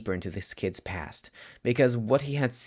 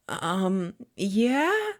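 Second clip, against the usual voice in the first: much higher, timid student voice; nervous boy answering his stern teacher; wavering with fear; 10 semitones higher, 3 dB louder than usual. Acted. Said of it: "His voice wavered with fear of his teacher".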